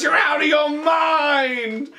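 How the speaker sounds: high, drawn-out imitated yell; mock yell. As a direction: holler it back high and slow. Assistant yelling back at scientist.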